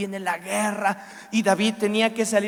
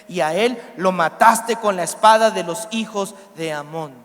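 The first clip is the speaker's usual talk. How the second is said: loud, forceful scripture reading; lectura bíblica predicada; 5 dB louder than usual. A narrator's reading. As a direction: declaim the prophet's accusation with emphasis. Reads scripture at full force. (lectura bíblica fuerte y acusadora)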